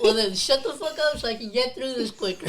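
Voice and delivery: loud teasing annoyance; playfully annoyed. Real, not acted. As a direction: snap back, grinning.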